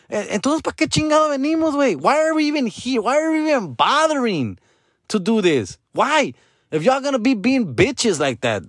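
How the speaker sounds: high, exasperated outburst; annoyed rant. Retold or imitated. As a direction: complain with rising disbelief. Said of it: "I, I go".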